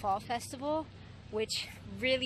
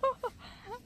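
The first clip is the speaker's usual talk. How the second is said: excited giggle; 11 semitones higher than usual. Real, not acted. Excited at the cows.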